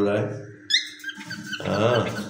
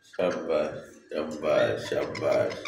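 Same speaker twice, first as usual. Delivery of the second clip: lower, softer murmured well done; soft praise; 6 semitones lower, 6 dB quieter, much slower than usual. Real, not acted. Owner softly praising his parrot for talking, lower and gentle. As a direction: praise the bird gently, winding down.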